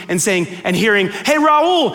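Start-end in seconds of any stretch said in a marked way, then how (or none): 1.1-1.9 high, bright-pitched hail across a room; called-out greeting. Retold or imitated. Friend greeting him as he enters, calling out. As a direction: call out to a friend walking in.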